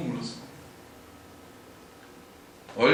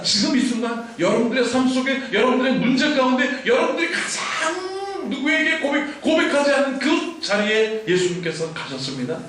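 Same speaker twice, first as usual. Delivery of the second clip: loud, high, urgent preaching; preaching at full force; 9 semitones higher, 6 dB louder than usual. Acted. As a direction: drive it home to the congregation. Sermon climax, rising intensity.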